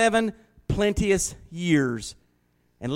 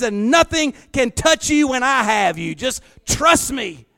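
louder, higher, rising sermon cry; building sermon climax, driving it home; full-force preaching; 7 semitones higher, 6 dB louder than usual. Acted. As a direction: preach it with mounting fire.

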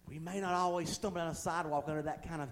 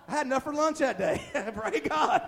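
raised voice breaking into a laugh; amused laughing; 6 semitones higher, 4 dB louder than usual. Real, not acted. Preacher joking about dollar bills in his hose, amused laughing. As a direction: land the punchline, then laugh. Laughs at own funny story.

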